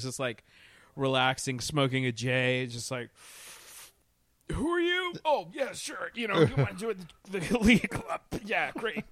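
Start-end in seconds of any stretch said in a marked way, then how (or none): 4.5-9.0 high, hammed-up careless shrug; mock bored Bruce. Mocking Bruce Wayne's shrugging welcome, higher than usual. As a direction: toss it off like you couldn't care.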